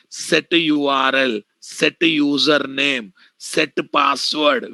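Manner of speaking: spelled out slowly for note-taking; slow dictation. Shown as a demonstration. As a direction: dictate each word slowly. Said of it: "teacher dictating method names".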